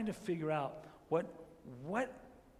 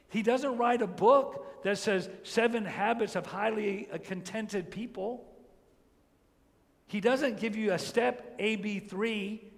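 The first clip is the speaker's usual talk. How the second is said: louder, higher, driving the point home to the congregation; full-force preaching; 5 semitones higher, 7 dB louder than usual. Acted. Preacher pressing the congregation, louder and higher preaching. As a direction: preach it out with rising fire.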